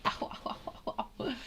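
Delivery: strained by a leg cramp, whispered ows; wincing through a cramp; getting a leg cramp. Real, not acted. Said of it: "I need to stretch my leg".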